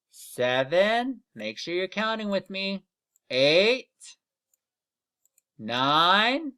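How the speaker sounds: put-on slow count-along for kids; counting aloud. Shown as a demonstration. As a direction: count each number out for the class. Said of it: "Let's count with me".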